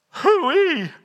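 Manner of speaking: high mock reaction to a stink; comic disgust. Preacher voicing Martha at the tomb, comically disgusted. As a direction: exclaim it high, playfully put off.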